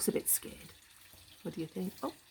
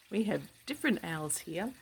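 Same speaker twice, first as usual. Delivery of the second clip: plain reading voice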